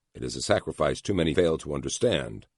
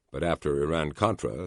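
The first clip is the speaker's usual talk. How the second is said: ordinary delivery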